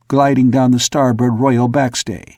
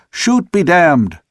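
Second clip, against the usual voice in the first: exclaiming; 5 semitones higher, 3 dB louder, slower than usual. A narrator's reading. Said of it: "exclaimed the old man".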